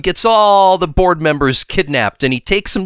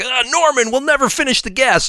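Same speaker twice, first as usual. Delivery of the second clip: lightly voiced nervous lab assistant, pitched higher; a quick, higher-pitched sidekick whine; 9 semitones higher than usual. Recapping the lab scene for his co-host, higher mock assistant voice. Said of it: "and he's like".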